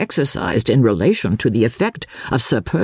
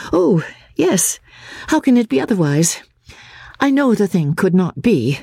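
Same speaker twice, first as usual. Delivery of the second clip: higher, a lightly voiced earnest young woman; Miss Halcombe; 6 semitones higher than usual. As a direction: answer as Marian, quick and slightly troubled. Miss Halcombe answers Gilmore.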